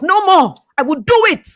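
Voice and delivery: rally the room with a loud promise; loud rallying vow. Shown as a demonstration. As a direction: shout the vow to yourself. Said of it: "Please say to yourself".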